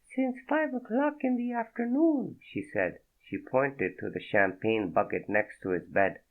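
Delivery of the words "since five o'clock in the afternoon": high, breathy-free French woman's voice, much higher; a startled French woman, voiced high; French accent. Naked woman startled by Fredo, in a high French accent. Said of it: "Thick French accent".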